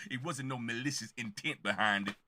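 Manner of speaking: low, softened imitation of a rapper; crisp, low take-off of a rapper. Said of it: "You know how T I be talking?".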